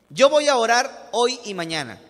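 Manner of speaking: loud, confident, hitting "Yo"; bold declaration. Retold or imitated. Student staking his faith before the teacher, loud and bold. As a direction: announce the bet with full conviction.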